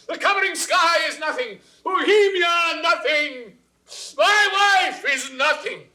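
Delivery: high, loud, unhinged rant; screaming king; frantic shouting. Acted. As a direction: spiral into jealous frenzy. King's jealous frenzy.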